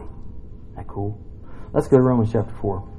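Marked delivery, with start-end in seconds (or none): 0.0-1.2 slow, much softer whisper; whispered aside. Real, not acted. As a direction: breathe it as a secret.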